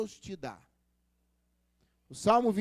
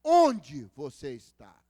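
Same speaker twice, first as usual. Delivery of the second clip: very low, louder and very slow; voice of God; 10 semitones lower, 6 dB louder, much slower than usual. Acted. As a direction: ask it slowly like a deep, solemn God. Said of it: "Deus vai falar assim". (voz de Deus, grave, alta e bem lenta)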